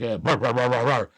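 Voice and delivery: low spoken mock barks; dog barking. Playing his lost husky for viewers, low mock barking. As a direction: imitate a big dog with repeated low woofs. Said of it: "runs around and barks at things".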